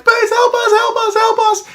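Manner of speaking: very high, panicked falsetto begging; desperate pleading. Retold or imitated. Trapped rebel soldier begs through the jammed door in falsetto panic. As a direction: shriek the plea as if trapped.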